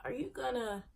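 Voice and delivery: very soft exasperated muttering under the breath; muttering to herself. Retold or imitated. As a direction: grumble it almost silently at the lost game. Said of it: "I'm just like".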